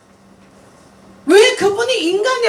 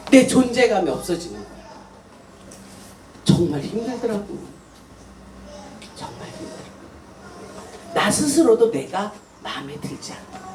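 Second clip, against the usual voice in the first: weary confession; 10 semitones lower, 8 dB quieter than usual. Real, not acted. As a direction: let it drop to a tired near-silence. Confessing a hard week.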